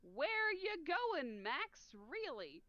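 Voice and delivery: drawn-out, lightly put-on Cajun accent; exaggerated Cajun-style questioning. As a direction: read it slowly with a hint of Cajun. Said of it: "the guy with the Cajun accent".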